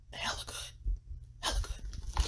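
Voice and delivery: hushed, voiceless praise; whispered. Real, not acted. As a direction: say it under your breath. Whispered emphatic praise of the music.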